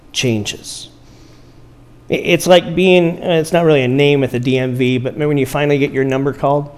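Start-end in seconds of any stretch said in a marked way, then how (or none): none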